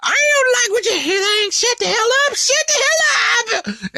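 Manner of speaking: high yelling mock Babidi. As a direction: screech it like an angry little wizard. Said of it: "Bodhi's like".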